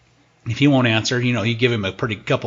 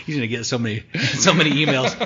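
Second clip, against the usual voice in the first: laughing; 4 semitones higher than usual. Real, not acted. Laughing at joke.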